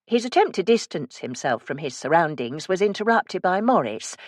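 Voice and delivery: ordinary delivery, nothing marked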